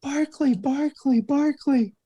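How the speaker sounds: high shrieking Barkley calls; imitated screaming fan. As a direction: shriek his name like a starstruck fan. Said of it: "some lady's screaming".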